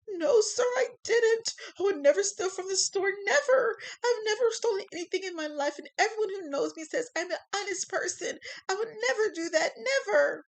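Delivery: high, loud, sobbing protest; tearful denial. Acted. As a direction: plead your innocence near tears. Accused man denies desperately.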